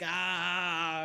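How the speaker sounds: mock monster cry of pain; a high imitated creature cry; pained grunt. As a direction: let out a short wounded yelp. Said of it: "he's like".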